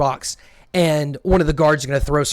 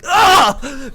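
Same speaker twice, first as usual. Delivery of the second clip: a high, loud anguished cry; 10 semitones higher, 6 dB louder than usual. Acted. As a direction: let out a pained yell mid-stab. Crying out while stabbing.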